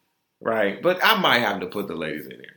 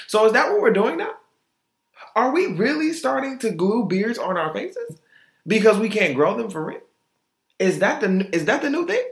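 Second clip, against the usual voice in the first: high, rising disbelief; incredulous; 9 semitones higher than usual. Real, not acted. Quizzing listeners about beard glue, high and incredulous. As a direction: pitch up in mock shock.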